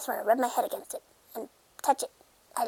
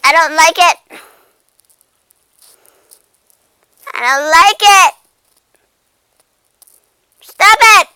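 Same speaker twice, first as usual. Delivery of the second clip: loud play-fight squeals; 6 semitones higher, 16 dB louder than usual. Pretend.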